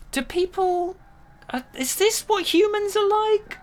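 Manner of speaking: a naive visitor asking about humans; mock alien wonder. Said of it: "has ever gone".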